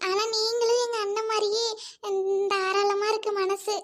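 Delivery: ordinary talk, nothing marked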